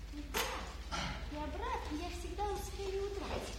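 very soft secret plan; whispered to herself. Acted. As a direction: hush it so nobody hears. Gerda alone, sneaking out.

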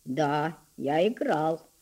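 put-on low, soft, shy boy's answer; slightly hoarse, timid. A narrator's reading. Boy answers the girl, hoarse from cold and shyness. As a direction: answer timidly, a little husky from frost. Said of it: "голосом несколько сиплым от мороза и от робости".

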